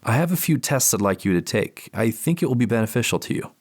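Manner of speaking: deliberate, calm clinical voice; measured therapist. Acted. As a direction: choose each word carefully. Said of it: "Their words are deliberate and measured".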